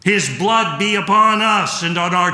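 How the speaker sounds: high, loud shout of triumph; triumphant yell. Acted. The crowd yells back at Pilate, loud and high in triumph. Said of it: "the people yelled".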